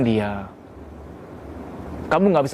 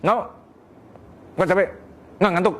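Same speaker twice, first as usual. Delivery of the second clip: short clipped "Nggak" repeated; curt refusal; 3 semitones higher than usual. Shown as a demonstration. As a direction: shut him down quickly. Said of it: "laki-laki nggak bisa yang". (istri menolak ketus)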